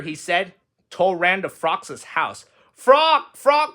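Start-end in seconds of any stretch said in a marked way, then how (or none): 2.7-3.7 high, loud excited calls; calling out. A narrator's reading. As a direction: call for your friend eagerly. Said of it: "cried Toad".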